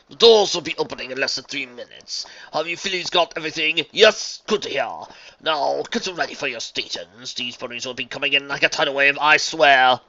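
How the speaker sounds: theatrical organizer with loud peaks; brief them briskly but grandly; grandiose stallion, all business. Acted.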